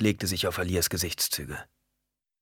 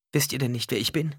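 put-on high, sweetly coaxing child; sweet little-girl voice; 7 semitones higher than usual. A narrator's reading. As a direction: ask like an innocent little girl. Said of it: "die süße und verlockende Stimme der kleinen Alia". (süße, verlockende Kinderstimme)

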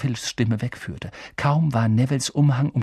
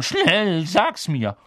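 higher, urgent interrogating voice; Filch questioning; 7 semitones higher, 3 dB louder, much slower than usual. A narrator's reading. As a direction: demand an answer as a harried caretaker. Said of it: "hörten sie Filch fragen".

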